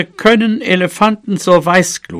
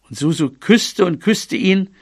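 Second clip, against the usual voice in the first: ordinary delivery, nothing marked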